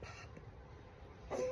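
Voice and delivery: faint breathless whisper; barely whispered. A narrator's reading. Dying woman calling to her father-in-law, whisper. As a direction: breathe the word out. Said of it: "she barely whispered".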